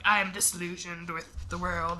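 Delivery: low, soft mock brooding British actor voice; a light send-up of a gloomy leading man; gravelly brooding actor. Friend mocking actors who go gravelly, low and soft. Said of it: "making his voice, like, gravelly".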